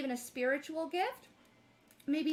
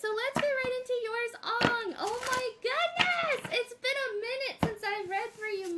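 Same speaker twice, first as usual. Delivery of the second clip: excited; 7 semitones higher, 4 dB louder than usual. Real, not acted. Starting reading for a friend.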